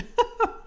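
high sudden laugh; burst of laughter. Real, not acted. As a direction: crack up loudly. Laughs at police twist.

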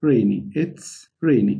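it's rainy drawn out for repeating; slow repeat for the class; slow model phrase. Shown as a demonstration. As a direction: say it's rainy slowly to copy. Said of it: "تاني".

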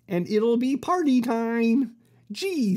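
ordinary delivery

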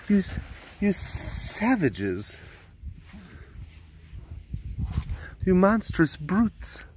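drawn-out mock-horrified scold; mock outrage. Keeper mock-scolds the cats over the bones, drawn out. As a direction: play the shocked aristocrat at the brutes. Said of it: "mock scolding cats".

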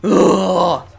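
loud, drawn-out groan; exasperated groan. Real, not acted. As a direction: groan loudly, fed up with it all. Fed up, wants to stop playing.

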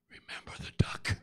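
threatening whisper in his ear; little sister whispering a quiet threat. Acted. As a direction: breathe it into his ear as a warning. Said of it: "whispers in his ear".

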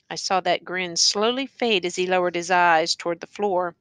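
ordinary delivery, nothing marked

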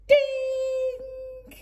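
ding like a shop till; high till ding. Acted. Narrator voicing the till, high ding. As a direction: sing a high bright ding.

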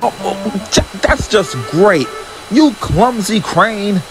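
slow, high dismayed grumble; Larry dismayed at the crane, slow. A narrator's reading. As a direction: sputter in dismay. Dismayed after crash.

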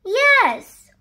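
high, loud hurt wail. A narrator's reading. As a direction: wail it like an injured child. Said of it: "wailed Little Donkey".

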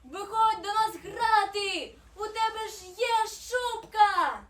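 high, loud, slow invitation; calling out. A narrator's reading. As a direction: shout an eager invitation to play. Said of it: "гукнула вона".

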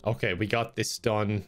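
usual speaking voice

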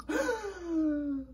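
mock pained gasp. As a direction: gasp then a little ow. Germ reveal reaction.

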